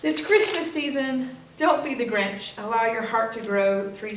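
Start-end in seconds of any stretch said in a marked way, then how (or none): none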